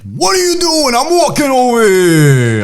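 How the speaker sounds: hammed-up high-pitched New York protest, last words stretched; angry New York accent. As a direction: caricature an irate New Yorker. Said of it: "flip back to that New York when you're pissed off".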